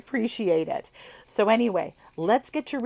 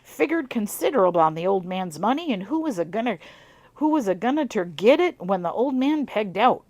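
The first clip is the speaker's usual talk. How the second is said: put-on amateur southern accent on an old farmer's tale; southern twang; 4 dB louder than usual. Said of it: "a bit of a southern twang".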